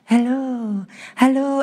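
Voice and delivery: high, sing-out mock greeting; cutesy shepherd. Voicing a cute picture-book shepherd.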